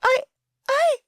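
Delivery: high, loud stammering gasp; shocked gasp. A narrator's reading. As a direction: choke on the words in shock. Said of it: "she gasped".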